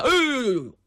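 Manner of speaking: long wailing yelp; yelp of pain. Acted. Captive howling as his uncle beats him, acted pain. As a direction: cry out as the blows land. Captive howls under the blows.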